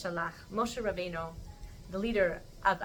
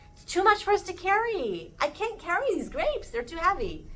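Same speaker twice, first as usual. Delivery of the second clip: high-pitched protest of being overburdened; complaining; 10 semitones higher, 4 dB louder than usual. Acted. Scouts complaining the giant fruit is too much, high-pitched. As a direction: whine mildly, voice pushed way up.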